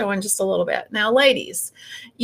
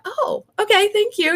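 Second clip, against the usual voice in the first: high-pitched, caught-off-guard thanks; pleasantly surprised; 10 semitones higher than usual. Retold or imitated. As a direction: sound taken aback but flattered. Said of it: "And I'm like".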